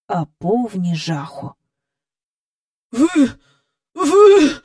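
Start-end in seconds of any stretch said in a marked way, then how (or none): 2.9-4.5 stuttering, rising to a frightened cry; stammering hoarse fear. A narrator's reading. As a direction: choke out the words in terror. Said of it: "заникуючись, хрипіла міс Ендрю".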